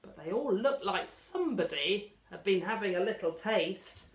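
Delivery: ordinary delivery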